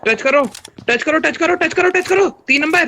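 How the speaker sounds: high, loud urgent pleading; urgent high shout. Real, not acted. Begging teammate to revive. (ऊँची घबराई चीख)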